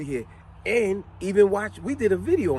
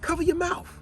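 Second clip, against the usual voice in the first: scolding quote; 12 semitones higher than usual. Retold or imitated. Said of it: "people like".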